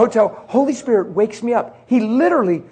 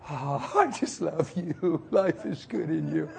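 slow, low, soft sigh of love; hushed, tender prayer; blissful contentment; 4 semitones lower, 13 dB quieter, slower than usual. Retold or imitated. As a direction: sink into the pillow, murmuring thanks. Settling into bed, blissful prayer.

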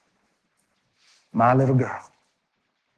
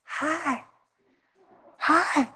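high, soft imitation of a weak whisper; breathy mock of a hoarse post-coma voice; imitating wife whispering through a trach tube; faint whispered hi; 13 semitones higher, 8 dB quieter than usual. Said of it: "whisper, trach tube".

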